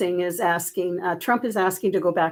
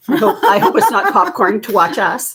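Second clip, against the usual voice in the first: laughing; 3 semitones higher, 8 dB louder than usual. Real, not acted. Joking about being watched while eating.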